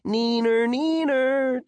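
high, drawn-out sing-song jeer; childish taunt. Acted. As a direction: taunt them like a stubborn kid. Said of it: "just to be stubborn, to say".

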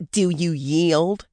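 slow, loud goblin taunt. A narrator's reading. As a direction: lean in and sneer the question. Redcap taunting in prison fight.

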